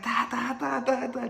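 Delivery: high, percussive "da da da"; imitated jab noises. Storyteller sounds out his jabs in round two, high rapid-fire. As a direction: punch out each syllable up high.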